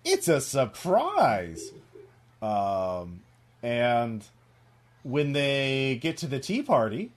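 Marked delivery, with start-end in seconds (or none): 0.0-1.7 high, slow, sing-song cartoon reply; teasing lilt, pitched up; Mister Mouse. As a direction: draw it out like a cartoon critter. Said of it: "Mister Mouse".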